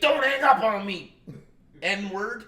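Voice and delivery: high, loud warning; the caller ringing back, loud and firm; insistent callback. Retold or imitated. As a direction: tell him not to dare hang up.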